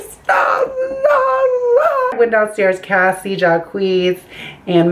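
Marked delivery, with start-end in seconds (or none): 0.0-2.1 high-pitched wailed stop; hammed-up, drawn-out shriek; mom's cry. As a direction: imitate mom wailing, pitch way up. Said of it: "my mom had to get into it, she was like".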